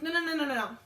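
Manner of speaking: loud, high rapid-fire string of no's; insistent objection. Retold or imitated. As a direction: cut in as a protesting customer. Customer objecting to the total.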